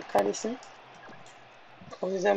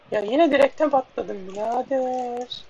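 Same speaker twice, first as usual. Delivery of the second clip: ordinary talk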